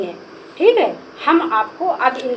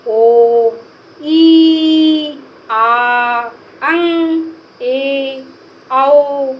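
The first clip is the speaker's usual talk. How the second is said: loud classroom chant of vowels; teacher calling vowels loud for children to repeat; loud vowel drill; 6 dB louder, much slower than usual. Shown as a demonstration. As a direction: call each vowel out loudly, one by one. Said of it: "तो हम इनको बोलेंगे".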